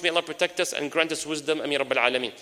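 normal talking voice

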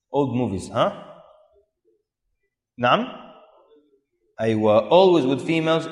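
ordinary talk

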